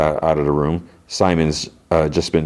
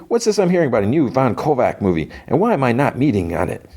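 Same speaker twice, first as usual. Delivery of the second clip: higher-pitched acted complaint; indignant demand; 8 semitones higher than usual. Actor phones about a movie he was left out of, indignant. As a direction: demand an explanation, pitch raised throughout.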